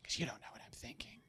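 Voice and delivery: whispered aside. Retold or imitated. Preacher mimicking the audience's inner thoughts, whispered. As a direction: drop to a whisper. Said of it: "don't do the Jim Gaffigan thing".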